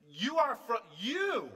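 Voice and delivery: "you" hit high and loud; stress on YOU. Shown as a demonstration. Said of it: "I want to emphasize".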